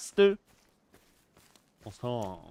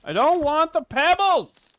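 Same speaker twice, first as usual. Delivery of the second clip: mock cartoon yell; 12 dB louder than usual. Game yelling, cartoon voice.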